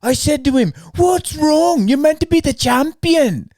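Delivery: high, loud mocking impression; mock Ian Gary retelling. Mocking the fighter's retelling to his friend, high hammed-up voice. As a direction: ham up the fighter's boast. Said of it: "And he's like".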